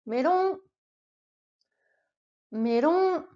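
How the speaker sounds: melon, with a light Japanese r-like l. Shown as a demonstration. Teacher modelling melon for learners. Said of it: "Vas-y, répète après moi".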